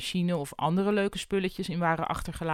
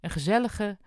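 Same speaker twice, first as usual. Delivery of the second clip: usual speaking voice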